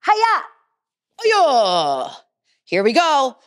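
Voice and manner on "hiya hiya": high, loud battle cry; martial arts kiai. Shown as a demonstration. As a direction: strike with a sharp kiai shout.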